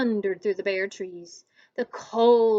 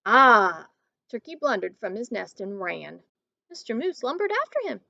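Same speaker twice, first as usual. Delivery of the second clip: ordinary delivery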